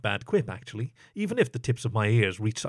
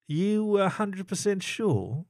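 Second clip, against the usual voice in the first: slow, higher, drawn-out question; lightly flirtatious; teasing; 8 semitones higher, much slower than usual. Acted. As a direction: coax him with a playful doubt. Narrator teasing, stepping closer.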